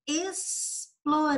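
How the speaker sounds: "explorando" slow, with a long S on "es"; demonstrating the S sound. Shown as a demonstration. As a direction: hold the hiss in "explorando", then finish the word. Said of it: "quando você estiver pronunciando".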